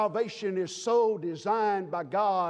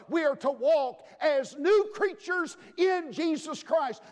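usual speaking voice

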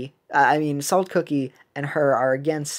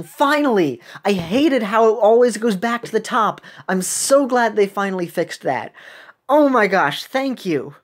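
excited; 5 semitones higher, 5 dB louder than usual. Real, not acted. Relieved at a long-awaited fix.

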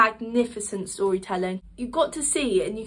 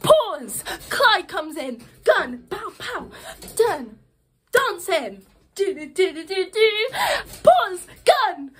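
acting out the scene; 7 semitones higher, slower than usual. Shown as a demonstration. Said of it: "literally just like that".

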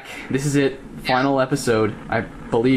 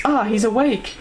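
put-on high girlish voice; 8 semitones higher than usual. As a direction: lift into a girly voice. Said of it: "That's my girl voice, by the way".

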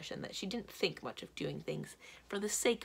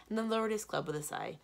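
plain reading voice

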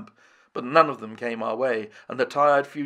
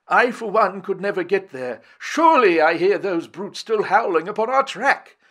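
higher, louder worried complaint; anxious old man; 7 semitones higher, 7 dB louder than usual. A narrator's reading. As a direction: fret and despair. Summerlee exhausted, fearful.

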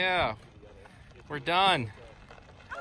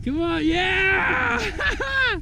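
cheering; 12 semitones higher, much slower than usual. Real, not acted. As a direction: cheer a friend on. Cheering a rider on.

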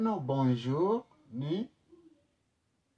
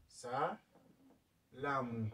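Salamu drawn out very slowly, sa-la-mu; teacher models Salamu slowly; slow model word; 8 dB quieter, much slower than usual. Shown as a demonstration. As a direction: stretch out Salamu syllable by syllable. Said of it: "Maana yake salamu kwa Kifaransa".

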